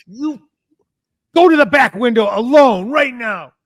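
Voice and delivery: mock angry order. Paranoid friend ordering him to the back window, angry. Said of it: "He's so mad".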